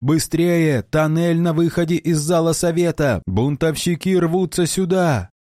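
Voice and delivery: high urgent shout of command. Acted. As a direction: yell the warning at the guards. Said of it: "и закричал".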